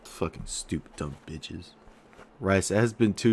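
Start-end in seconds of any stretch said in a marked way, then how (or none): 0.0-1.7 muttered insult. Acted.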